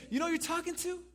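high-pitched, affronted question; incredulous challenge. Acted. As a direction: challenge the doubter with rising disbelief. Acting Jesus taken aback by doubt.

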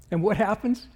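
ordinary talk, nothing marked

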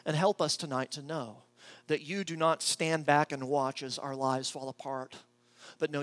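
soft, reverent prayer voice; closing prayer over soft music; hushed prayer. Acted.

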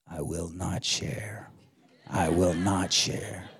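low, slow, hushed little echo; Curtis. Retold or imitated. Four-year-old son echoing the sermon, little voice. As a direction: imitate a small child quietly copying the preacher. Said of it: "I heard this little voice".

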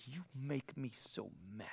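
very quiet, low mock grievance; hushed, understated imitation of an angry adult; imitated fifty-five-year-old. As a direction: say it softly and low, as a grown adult's complaint. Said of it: "I've counseled fifty-five-year-olds".

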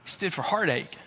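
hushed, tender preaching; quiet sermon. Acted. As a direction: drop down and speak it gently. Quieter reflective sermon stretch.

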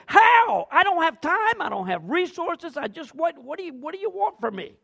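high, loud flustered protest that fades as it goes; an overwhelmed disciple pleading, at a loss; exasperated protest. Retold or imitated. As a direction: throw up your hands, can't cope.

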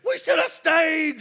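a loud, drawn-out wail of regret; shouted complaint. Shown as a demonstration. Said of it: "you gotta get your hands out".

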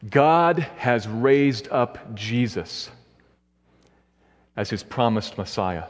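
each word laid down slowly; slow and deliberate. Shown as a demonstration. Pastor stating a main point, slow and weighty. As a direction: spell out the key claim for the congregation. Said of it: "Here's the first observation".

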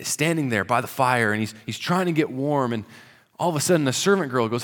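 ordinary delivery

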